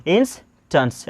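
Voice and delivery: इंस्टेंस slowly, as 'ins', pause, 'tuns'; slow two-part इंस्टेंस with short final vowel; demonstrated reading. Shown as a demonstration. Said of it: "इसको क्या पढ़ेंगे?".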